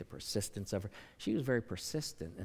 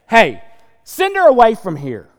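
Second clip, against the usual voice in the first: loud high shout to send her away; apostles shouting; 11 semitones higher, 21 dB louder than usual. Retold or imitated. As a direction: bark it out like impatient disciples. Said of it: "And they're saying".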